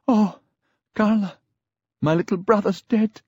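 high-pitched, acted tearful grief; distraught young woman on the verge of sobs; sobbing. A narrator's reading. Friend phoning with news of a death, tearful. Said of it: "Frieda was sobbing".

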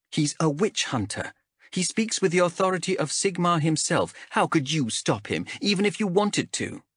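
lightly voiced guarded young ranger; a hint of a defensive wanderer; young woman character voice. Chev voiced by narrator.

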